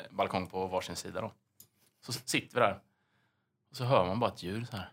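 ordinary delivery

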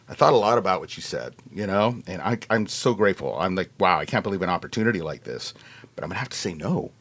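low, soft, re-enacted earnestness; sincere refusal. Retells gently turning James down.

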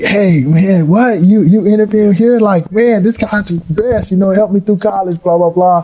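imitating old players gushing, pitched high; hyped-up, playful praise from the locker room; excited players. Former students spotting him at the interview, mock-excited gushing praise.

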